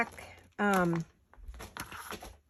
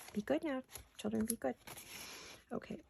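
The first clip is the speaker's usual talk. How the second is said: soft coaxing; 2 semitones higher, 14 dB quieter than usual. Real, not acted. Coaxing pets off camera.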